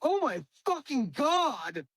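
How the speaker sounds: high, drawn-out mock gasp of shock; mock shocked exclamation. Friend imitates actress reading her lines in front of her kid, high and slow. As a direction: play the horrified mom reading the script aloud, slowly. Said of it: "she goes".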